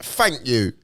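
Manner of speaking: Cockney "Thank you" with F for TH; London accent demo. Shown as a demonstration. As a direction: show off the unguarded London accent. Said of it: "Usually I'm like".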